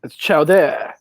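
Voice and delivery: put-on French-accented "chowder", stress toward the end; mock French chef accent. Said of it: "The French chef who is like".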